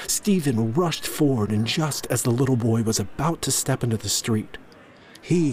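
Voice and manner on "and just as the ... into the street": ordinary delivery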